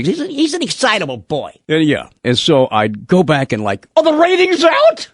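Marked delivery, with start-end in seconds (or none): 0.0-1.5 high-pitched mock impression; high, put-on teasing voice; Moody. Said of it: "Moody used to say". 4.0-5.1 high, loud hammed-up question; mock excited. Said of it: "I get a little excited".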